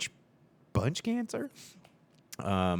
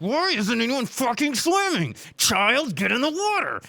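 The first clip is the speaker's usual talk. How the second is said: higher, louder hammed-up yell; mock angry mayor; 12 semitones higher, 6 dB louder than usual. The Jaws mayor ordering his son in, mock yell. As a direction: bark it like a furious mayor. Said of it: "the mayor's like".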